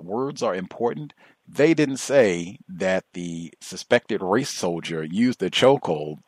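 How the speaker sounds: ordinary delivery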